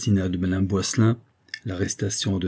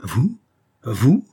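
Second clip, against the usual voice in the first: incredulous cry, each word swooping up; astonished, sharply rising questions; stunned exclamation; 3 semitones higher, 5 dB louder than usual. A narrator's reading. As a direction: blurt it out in disbelief. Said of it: "s'écria le chimiste".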